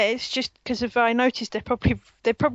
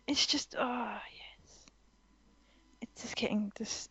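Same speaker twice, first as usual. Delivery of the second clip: whispering; 13 dB quieter than usual. Real, not acted. Whispered delight.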